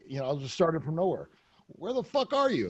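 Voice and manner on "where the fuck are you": high, playful fake demand; mock demanding. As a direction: tease her with a mock demand, pitched up. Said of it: "joking around".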